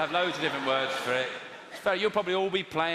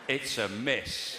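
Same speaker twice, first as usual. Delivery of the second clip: slow, gloating mock repeat; mock gleeful; 5 semitones lower, much slower than usual. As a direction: drag it out like savouring a miss. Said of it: "You went".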